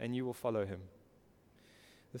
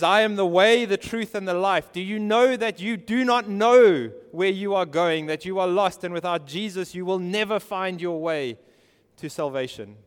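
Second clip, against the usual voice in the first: high, loud pulpit proclamation; impassioned preaching; 5 semitones higher, 10 dB louder than usual. Real, not acted. Preacher urging the lost toward Jesus, impassioned. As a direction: preach it out with rising force.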